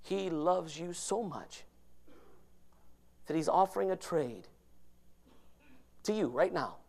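soft altar call. Acted. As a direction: plead gently, almost tender.

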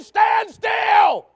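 loud, high command; God's loud, high call to stop; commanding shout. Pretend. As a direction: order them to freeze, full voice. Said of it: "God's saying".

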